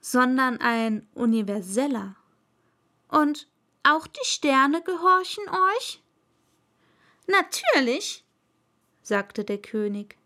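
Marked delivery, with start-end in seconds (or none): 3.1-6.0 put-on higher boyish voice, a little louder; a touch of a courteous little prince; polite prince. Prince asks. 7.3-8.2 high, loud royal order; pompous king's decree; commanding king. Acted. King answers.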